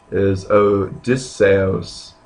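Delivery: "Odysseus" slowly, the e and u kept apart; separated vowels. Shown as a demonstration. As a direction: draw out each vowel of the name. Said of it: "a little bit separated".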